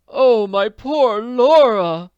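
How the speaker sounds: very high, loud, slow anguished wail; groaning lament. A narrator's reading. Jacob Aborn mourns Laura's lost fortune, a high slow wail. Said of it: "Jacob Aborn groaned".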